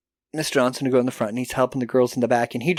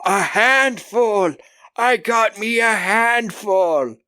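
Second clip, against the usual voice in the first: slow, higher leering villain voice; leering creep; 7 semitones higher, slower than usual. Retold or imitated. Janitor gloating to the teacher, slow leer. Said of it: "And he's like".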